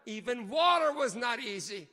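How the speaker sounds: usual speaking voice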